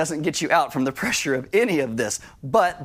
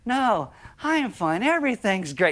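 mock forced cheer; 5 semitones higher than usual. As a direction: play someone insisting they're fine through clenched calm. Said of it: "smoldering behind their eyes".

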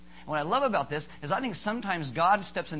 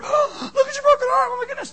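mock-hysterical wail, very high and loud; freaking out; 9 dB louder than usual. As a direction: imitate a panicked onlooker rushing over. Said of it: "sees this person freaking out".

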